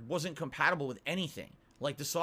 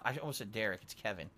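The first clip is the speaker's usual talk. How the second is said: chuckling softly through it; laughing at a slip; 6 semitones lower, 6 dB quieter than usual. Real, not acted. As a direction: laugh at your own mistake. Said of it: "laughs at own slip".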